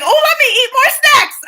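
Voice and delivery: high, loud, delighted cheer; mock-triumphant, high and loud; gleeful. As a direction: cheer at a sneaky loophole. Gleeful new plan.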